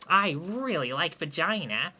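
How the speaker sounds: gleeful. Acted. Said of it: "pipes up with glee".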